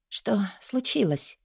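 soft, slow, slightly husky question; hoarse question. A narrator's reading. Heroine asks why he stopped, soft and husky. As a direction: ask slowly under the breath. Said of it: "Хрипло поинтересовалась я".

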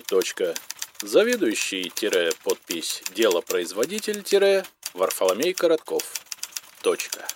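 formal bureaucratic sign-off; telephonogram dictation. A narrator's reading. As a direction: read punctuation aloud as dictation.

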